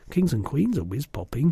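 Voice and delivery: plain reading voice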